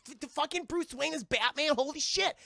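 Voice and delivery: high-pitched mock astonishment; hammed-up disbelief at a huge secret; amazed discovery. Character discovers Bruce is Batman.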